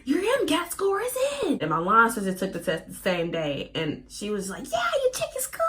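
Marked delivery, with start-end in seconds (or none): 0.0-1.6 high-pitched mock hype; excited announcement. Email announcing scores are in, hyped-up mock excitement. 4.7-5.6 very high mock squeal; eager line sister. Line sister asking about the test score, high mock excitement.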